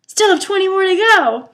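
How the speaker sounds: high, strained sigh of dread at the miles left; mock weary groan. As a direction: play your own worn-out self dreading the distance.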